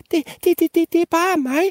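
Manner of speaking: very high stammering peep; tiny squeaky voice. Acted. Littlest goat answering the troll, very high stammered peep. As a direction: peep it out as a teeny scared goat. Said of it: "pippede den mindste bukke Bruse med sin lille bitte stemme".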